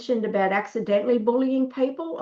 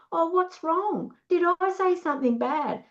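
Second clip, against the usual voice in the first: coaxing concern; 7 semitones higher, 3 dB quieter than usual. Acted. Interrogator coming after the aloof one, mock-concerned coaxing. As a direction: coax anxiously, as a put-on. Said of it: "they have to come looking for you".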